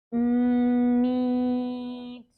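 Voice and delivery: drawn out slowly. Shown as a demonstration. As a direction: stretch Meet out long. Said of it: "slow and then fast".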